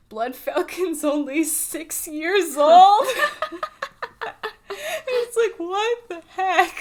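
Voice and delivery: laughing through the words; high, giggling disbelief. Real, not acted. Laughing at absurd cartoon fact.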